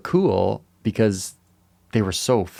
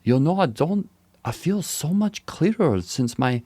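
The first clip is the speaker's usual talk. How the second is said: higher-pitched, badly faked German accent; terrible German accent; 6 semitones higher than usual. Podcaster imitating a German guy without his phone, bad German accent. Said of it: "in his German accent".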